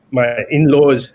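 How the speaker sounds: choking up. Real, not acted. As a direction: get choked up mid-sentence, fighting tears. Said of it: "sorry, I'm choking".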